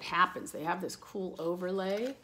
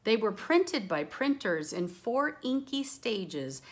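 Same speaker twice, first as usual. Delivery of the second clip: put-on lively rhyming read-aloud for kids; bouncy picture-book voice; reading to children; 6 semitones higher, 6 dB louder than usual. A narrator's reading. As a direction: read it like a storybook to a class.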